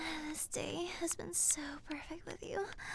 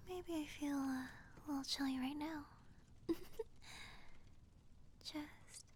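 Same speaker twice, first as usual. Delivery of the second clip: cozy, soft loving whisper; tender whisper; 3 semitones lower, 6 dB quieter than usual. Pretend. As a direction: whisper it while cuddling.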